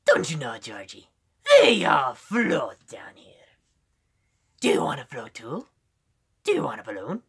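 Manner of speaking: half-whispered creepy clown, swooping in pitch; Pennywise. Shown as a demonstration. Clown tempting Georgie, creepy Pennywise voice. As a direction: lure the child in with an eerie swooping voice. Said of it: "a pretty good Pennywise impression".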